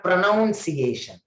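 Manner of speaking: pronunciation with "noun" in the second syllable; wrong pronunciation. Shown as a demonstration. Teacher showing what it is not, pronunciation said with "noun". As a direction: say pronunciation as "pro-NOUN-see-AY-shun". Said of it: "It is not pronunciation".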